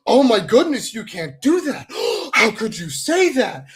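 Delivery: loud, high, scandalized mimicry; hammed-up pearl-clutching shock; mock outrage. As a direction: gasp in exaggerated horror, mocking the critics. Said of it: "someone goes".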